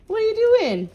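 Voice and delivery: very high, loud question to a pet; high-pitched playful challenge; pet talk. Real, not acted. Talking to a pet.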